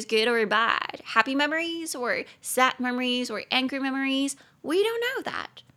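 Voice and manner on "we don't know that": high, slow, drawn-out puzzled tone; mock puzzled. Teacher teasing students about the unknown, high and slow. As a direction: play up the mystery.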